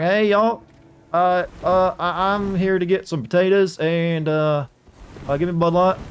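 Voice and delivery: hammed-up Southern US accent, high and loud; mock Southern drawl. Streamer mocks Southern talk for chat, exaggerated Southern accent. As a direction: play a Southern yokel ordering at the store, Southern accent. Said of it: "That's me doing my best to sound Southern".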